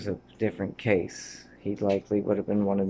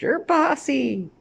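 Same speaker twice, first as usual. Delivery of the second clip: much higher, louder, slow teasing shout; playful shout; 9 dB louder, slower than usual. Pretend. Punning on Bossi, a slow high teasing shout. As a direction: squeal it out as a tease.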